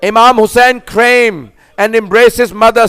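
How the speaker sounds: ordinary delivery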